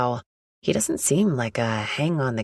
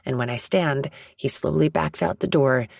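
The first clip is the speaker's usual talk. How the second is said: normal talking voice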